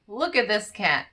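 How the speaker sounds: ordinary talk, nothing marked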